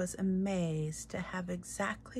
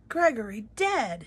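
loud, higher cry of alarm; shout; 4 semitones higher, 9 dB louder than usual. A narrator's reading. Cook shouting that Gregory is dead. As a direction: cry out the news. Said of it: "shouted Cook".

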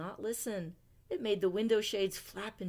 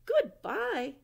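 high, loud farewell call; 9 semitones higher, 6 dB louder than usual. Acted. Owl calling after departing Winter, loud. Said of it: "called Owl".

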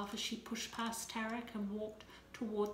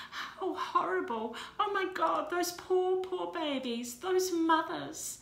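high, loud, slowing horrified outcry; 9 semitones higher, 6 dB louder than usual. Acted. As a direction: cry out in horror and pity. Reading of dead babies.

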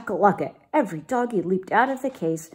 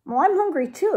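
higher, lively agreement; kitty boy voice; 10 semitones higher, faster than usual. Acted. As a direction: chime in eagerly. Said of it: "agreed the gatito".

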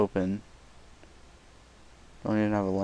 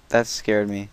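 ordinary talk, nothing marked

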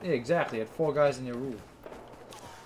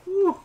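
high excited whoop; 6 dB louder than usual. Real, not acted. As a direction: cheer the hit. Celebrating a hit.

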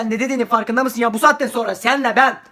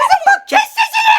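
put-on much higher, shrill-sounding mean girl; bully girl voice; 4 dB louder than usual. Bully girl snaps at the girl in the toilet. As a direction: play a loud, nasty bully.

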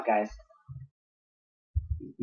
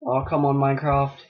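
ordinary talk, nothing marked

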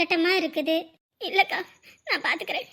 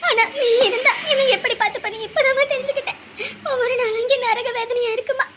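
sobbing apology; 4 semitones higher than usual. Acted. Girl begs mother's forgiveness.

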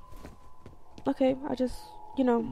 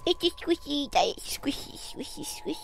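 playful unvoiced whisper; whispering to a squishy; 3 semitones higher, 7 dB quieter than usual. Gamer whispering to his squishy toy, playful whisper. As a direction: breathe it to the toy like a secret.

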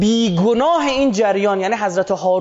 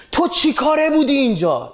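mild mock yell, pitched up; retold angry yell; 6 semitones higher than usual. Moses confronting Harun over the calf, mild retold yell. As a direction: raise the pitch in a half-shouted rebuke. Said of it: "داد زد سرش".

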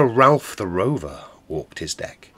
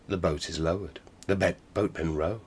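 ordinary delivery, nothing marked

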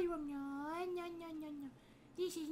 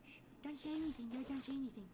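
hushed and much softer, a little fast; urgent hush; 6 semitones lower, 11 dB quieter, faster than usual. Acted. Scared kid hushing a player so his mom won't hear. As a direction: shush them before mom hears.

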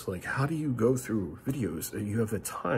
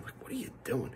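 higher, voiceless, much softer disbelief; exasperated whisper; 8 semitones higher, 8 dB quieter than usual. Real, not acted. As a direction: breathe it out in quiet disbelief. Frustrated aside to flaggers.

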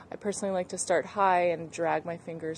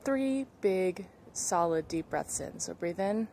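calm breathing guide voice; guided breathing. Acted. Leads breathing warm-up.

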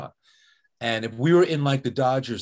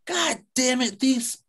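imitating an old coach's furious outburst, much higher; mock outrage; 13 semitones higher, slower than usual. Guest imitating Tommy cursing the Astros cheating, hammed-up outrage. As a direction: blurt it out like a disgusted old baseball man.

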